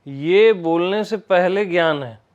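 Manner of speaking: ordinary delivery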